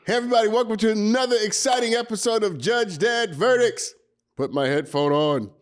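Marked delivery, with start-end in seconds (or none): 0.1-3.9 upbeat host opening; bright announcer welcome to listeners; show intro. Acted. Podcast host welcoming listeners, upbeat announcer read.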